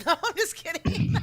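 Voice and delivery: loud laugh through the words. Real, not acted. Joking about the counseling bill, laughing loudly. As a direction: laugh it off, just kidding.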